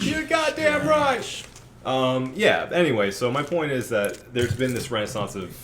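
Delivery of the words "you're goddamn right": shouting. Acted. Emphatic agreement joke.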